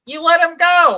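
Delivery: high, loud accusing shout at the screen; mock outraged yell. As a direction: yell it at the TV in disbelief. Yelling at Rose on screen.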